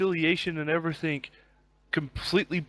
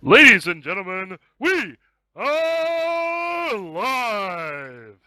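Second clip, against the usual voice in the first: drawn-out ring announcer call; Bruce Buffer intro; 5 semitones higher, 6 dB louder, much slower than usual. Acted. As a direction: stretch it like a fight announcer. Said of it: "that Bruce Buffer intro".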